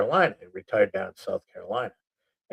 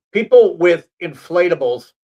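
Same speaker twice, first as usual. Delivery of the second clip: emphatic; 8 semitones higher, 10 dB louder than usual. Acted. Mock announcing list entry.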